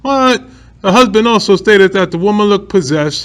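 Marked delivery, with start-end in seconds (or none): 0.0-0.4 surprised what. Real, not acted. Reacting to devil claim.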